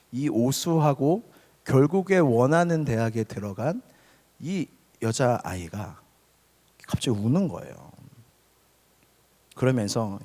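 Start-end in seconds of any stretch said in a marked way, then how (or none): none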